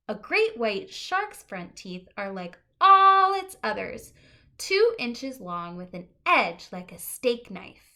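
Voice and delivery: lively picture-book read-aloud; reading to children. Acted. Teacher reading a book to the class, lively and clear.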